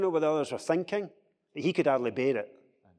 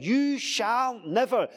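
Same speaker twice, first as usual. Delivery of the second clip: determined, higher and louder, slow on each word; emphatic refusal; 9 semitones higher, 5 dB louder, slower than usual. Retold or imitated. Peter doubles down against being washed, emphatic. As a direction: dig in and refuse, weighting every word. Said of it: "He is emphatic. He's almost, like, determined".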